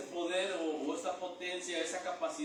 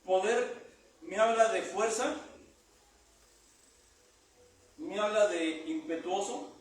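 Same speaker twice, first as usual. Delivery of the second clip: preaching at full force; 4 dB louder than usual. Acted. Preacher driving home the meaning of power, loud. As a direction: hammer each word home to the church.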